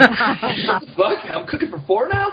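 loud high startled outburst; surprised. Real, not acted. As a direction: caught off guard, laughing. Surprised at surprise guest news.

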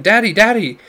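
high, loud joyful calls of Daddy; children crying out for their father, thrilled; excited cry. A narrator's reading. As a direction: call out to him with delight. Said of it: "they cried".

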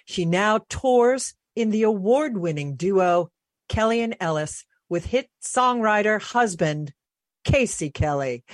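ordinary talk, nothing marked

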